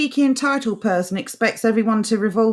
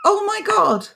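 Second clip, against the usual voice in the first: high laughing oh my God; laughing disbelief; 12 semitones higher than usual. Real, not acted. She laughs at his mistake with high disbelief. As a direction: squeal through laughter in disbelief.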